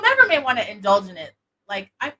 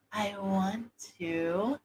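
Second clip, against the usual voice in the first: slow, hushed, seductive; sexy whisper; 8 dB quieter, much slower than usual. Shown as a demonstration. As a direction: draw it out softly into the ear. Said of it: "whisper in their ear and say things like".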